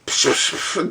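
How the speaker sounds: hissy mouth-made crash of coins; voiceless bursts of pouring change; imitated coin-dumping noise. Customer dumps coins on the counter, imitated pouring noise.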